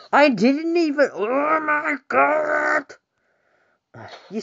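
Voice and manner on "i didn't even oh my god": high, drawn-out outraged wail; swearing tirade. Real, not acted. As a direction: cry out in disbelief. Raging at the game.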